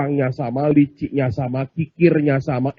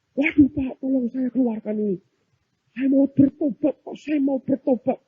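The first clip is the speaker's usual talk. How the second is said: high-pitched begging for release; mock pleading cry; 8 semitones higher, 4 dB quieter than usual. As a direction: plead urgently in a high voice, imitating a desperate prisoner. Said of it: "teriak-teriak, cari gereja". (pura-pura memohon dengan suara tinggi)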